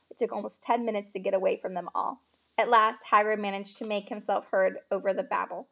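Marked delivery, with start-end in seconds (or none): none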